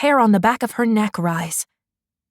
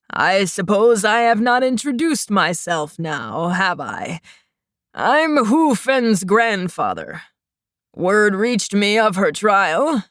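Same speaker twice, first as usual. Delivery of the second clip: put-on wheezy old man; 2 semitones lower than usual. A narrator's reading. Old man figure in story.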